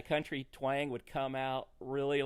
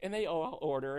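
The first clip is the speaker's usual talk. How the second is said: usual speaking voice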